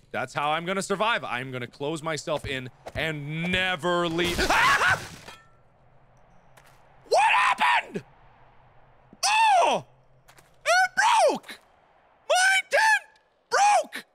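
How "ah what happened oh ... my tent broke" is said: shrieking in real fright; panicked high screams; scared horror game streamer. Real, not acted. Tent breaks, jump scare.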